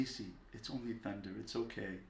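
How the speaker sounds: put-on soft, soothing reassurance to a frightened child; gently calm a scared little one; hushed, tender parent voice; comforting. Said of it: "And we comfort the child".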